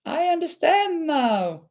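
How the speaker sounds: mock child's dawning realization, high and bright; child getting it. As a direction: sing out the dings like a light bulb going on.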